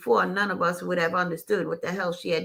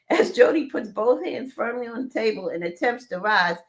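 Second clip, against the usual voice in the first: plain reading voice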